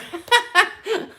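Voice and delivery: real high-pitched laugh breaking out; laughing hard at her own joke; burst of high laughter. Real, not acted. Laughing at her own calm down joke, high burst of laughter.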